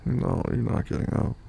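ordinary delivery

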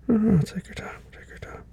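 soft, whispered breathing coach; calming whisper. Acted. Reassuring partner.

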